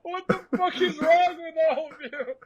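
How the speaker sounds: high shrill disbelief, burning face; mock outraged shriek. Telling friends about smelling salts, high outraged squeal. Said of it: "I'm like".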